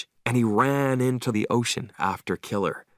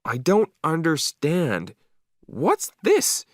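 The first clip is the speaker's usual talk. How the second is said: puzzled dog thoughts; 7 semitones higher, slower than usual. Pretend. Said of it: "I could read his thoughts".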